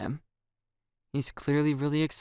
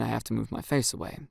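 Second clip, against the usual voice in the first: plain reading voice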